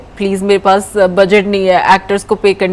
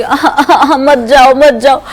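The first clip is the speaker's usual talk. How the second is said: hammed-up whiny begging, louder and higher; dramatic pleading; 5 semitones higher, 5 dB louder, slower than usual. To the little kids leaving, mock dramatic pleading. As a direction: overdo the please-don't-go. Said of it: "कितना drama है तुम्हारे अंदर".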